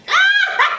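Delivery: sudden high-pitched burst of laughter; high shrieking laugh. Real, not acted. Laughing at cousin's face.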